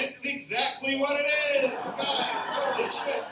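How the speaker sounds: high, loud comic yell; gleeful shout. Acted. Comic admitting the rumor about art school, shouting. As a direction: shout it as a delighted revelation.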